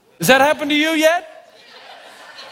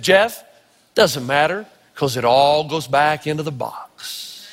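lower, slow, teasing grandma with a laugh; grandma's gloating taunt; 9 semitones lower, slower than usual. Retold or imitated. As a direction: gloat like grandma sweeping the board away. Grandma taunting after beating him.

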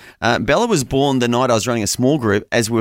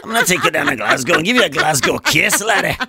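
much higher, hammed-up Scottish brogue; exaggerated Scottish accent, higher and playful; 12 semitones higher than usual. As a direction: play a cartoon Glaswegian tough guy. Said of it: "I want the Scottish accent".

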